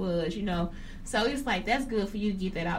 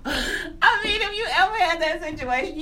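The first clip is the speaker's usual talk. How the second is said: higher and louder, laughing through words; laughing while talking; 5 semitones higher, 3 dB louder than usual. Real, not acted. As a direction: keep laughing as you tell it. Still laughing telling story.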